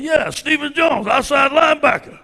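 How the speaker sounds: high, loud, slow answer; proud shout. Retold or imitated. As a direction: bark the name out proudly. Retold gym exchange, shouted answer.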